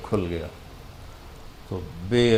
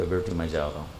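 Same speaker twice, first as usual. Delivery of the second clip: softer and lower, a hushed goodbye to a daughter; tender farewell; 5 semitones lower, 8 dB quieter, faster than usual. Retold or imitated. Father telling daughter Fatima he is going, softly tender. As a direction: gently break the news of leaving.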